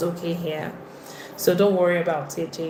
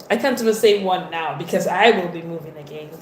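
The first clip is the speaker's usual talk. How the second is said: ordinary talk, nothing marked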